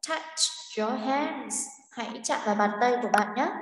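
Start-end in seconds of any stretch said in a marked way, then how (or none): 0.1-1.6 slow. Shown as a demonstration. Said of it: "echoes the recording".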